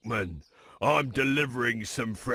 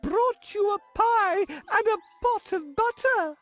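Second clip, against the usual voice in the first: much higher, louder put-on girl's voice; the wolf fakes a sweet little girl's voice; squeaky falsetto imitation of a child; pretending to be a little girl; 4 dB louder than usual.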